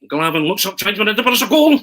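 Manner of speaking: rising to a loud, high goal call; football commentator building to a yelled goal; Arthur Montford impression. Young fan doing his commentary impression for Arthur himself. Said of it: "I used to do an impersonation of you".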